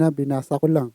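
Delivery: normal talking voice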